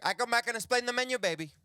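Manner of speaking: high, loud waitress voice; Cuban waitress. Acted. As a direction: play a waitress brushing off a customer.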